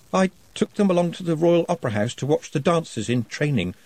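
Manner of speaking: mild hesitant nervousness; a touch nervous, careful; nervously. A narrator's reading. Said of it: "said Nutt nervously".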